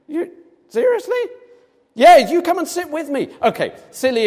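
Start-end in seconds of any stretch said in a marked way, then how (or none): 0.1-1.2 very high, stunned seriously?; high-pitched can't-believe-it question; incredulous. Pretend. Listener doubting the Queen's offer. 2.0-3.3 insistent. Pretend. Queen insisting you join her.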